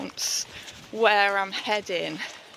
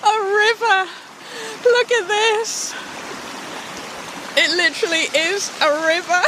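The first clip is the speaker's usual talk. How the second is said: laughing disbelief; 10 semitones higher than usual. Real, not acted. Sees flooded path, laughing.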